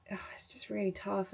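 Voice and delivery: breathy whisper of frustration; whispering how tough it is. Real, not acted. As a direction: drop to a whisper, fed up. Frustrated sigh mid-rant.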